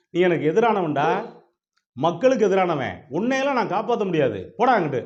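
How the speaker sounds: high, loud, indignant dismissal; angry refusal. Acted. God refuses to save his enemy, angry and loud. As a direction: send the enemy packing, loudly.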